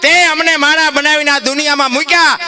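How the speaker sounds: high, loud, fervent cry; impassioned preaching. Acted. Preacher voicing saints crying to God, fervently. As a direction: cry out to the Lord with longing.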